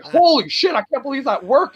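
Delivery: high-pitched retold disbelief; amazed relief. Performer recalling his promo gamble paying off, high amazed voice. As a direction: replay your own astonishment, starting high.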